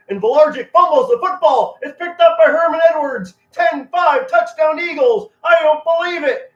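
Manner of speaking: excited radio play-by-play call, high and loud; frenzied football announcer losing it over a miracle touchdown; Merle Reese impression. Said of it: "Merle Reese going crazy".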